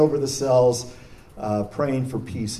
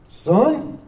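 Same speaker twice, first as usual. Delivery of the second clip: high, loud Alabama holler; Alabama accent; 7 semitones higher, 6 dB louder than usual. Retold or imitated. Warden summons the chaplain into his office, Alabama accent. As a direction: call him over, ringing out in an Alabama accent. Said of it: "my best Alabama I can do".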